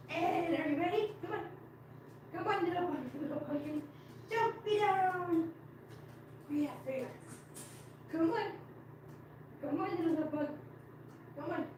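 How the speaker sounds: high, soft pet-talk voice; coaxing cats. Real, not acted. Owner inviting her kitties out to play, high soft coaxing. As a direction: sweetly coax shy pets out.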